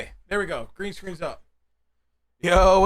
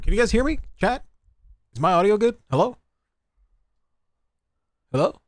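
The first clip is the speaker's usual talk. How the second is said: loud call from across the room; 3 semitones higher, 6 dB louder, faster than usual. Real, not acted. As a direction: shout it over to the mic from afar. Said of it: "yelling across the room".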